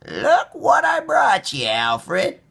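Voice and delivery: high, slow playful granny; grandma voice. A narrator's reading. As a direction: tease the dog like a grandma. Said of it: "Grandma took a ball".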